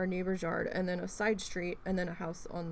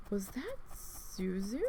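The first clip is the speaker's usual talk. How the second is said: hushed; 6 dB quieter, slower than usual. Retold or imitated. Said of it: "and I'm like".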